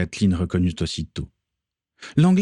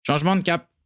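called out order; 10 semitones higher, faster than usual. A narrator's reading. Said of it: "s'écria le vampire".

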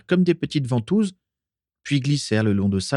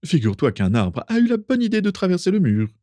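higher, lilting tall tale; breezy singsong; 10 semitones higher than usual. A narrator's reading. Fergus explaining the broken wall to Carla, breezy. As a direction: chirp the absurd excuse along.

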